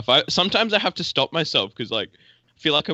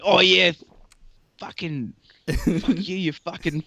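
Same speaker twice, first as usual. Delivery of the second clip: hammed-up Aussie accent, higher pitched; extra Australian; 5 semitones higher than usual. Australian abroad putting it on for Americans, extra Australian. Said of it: "just be extra Australian".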